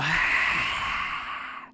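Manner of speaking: long exhale with the tongue out; breathy dragon exhale. Shown as a demonstration. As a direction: breathe out hard through the mouth.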